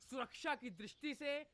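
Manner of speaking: mock newsreader delivery; news read.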